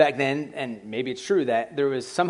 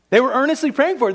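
fast, much higher and louder, the stress on "praying"; excited rush; 9 semitones higher, 5 dB louder, faster than usual. Shown as a demonstration. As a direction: rattle it off in disbelief, higher and louder. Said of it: "Why didn't they believe it then?".

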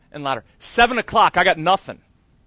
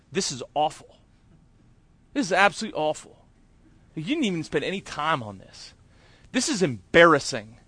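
inner critic voice; 4 dB quieter than usual. Retold or imitated. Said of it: "I can hear the voice".

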